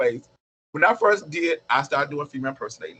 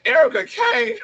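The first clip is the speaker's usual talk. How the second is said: high disbelieving echo of the name; outraged disbelief at the nickname; incredulous; 4 dB louder, slower than usual. Retold or imitated. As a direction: react in disbelief, pitched way up.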